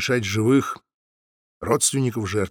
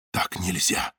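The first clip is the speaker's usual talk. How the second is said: whispered; 6 semitones lower, 6 dB quieter, slower than usual. A narrator's reading. Zaytsev's inner thought.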